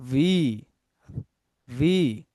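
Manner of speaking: "ভি" said slowly, set apart from "বি". Shown as a demonstration. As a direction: contrast "ভি" with "বি". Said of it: "পার্থক্যটা কি দেখেন". ("ভি" আলাদা করে উচ্চারণ)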